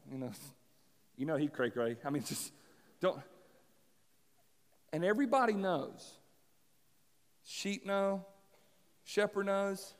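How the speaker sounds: usual speaking voice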